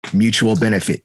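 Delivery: ordinary delivery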